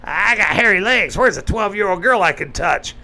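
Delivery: a sneering caricature, voice pushed up; mock Joe Biden. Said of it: "'cause Joe Biden, President of the United States, said".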